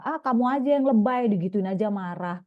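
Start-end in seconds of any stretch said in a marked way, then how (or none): 0.0-1.3 scoffing, louder brush-off; mock the child's feelings as overdone; dismissive parent. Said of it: "terus kita bilang". (ibu meremehkan perasaan anak dengan nada mengejek)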